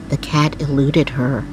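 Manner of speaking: usual speaking voice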